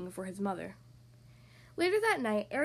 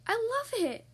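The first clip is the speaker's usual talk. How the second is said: high, delighted exclamation; thrilled; 10 semitones higher than usual. A narrator's reading. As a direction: burst out with joy. Ariel delighted by gift.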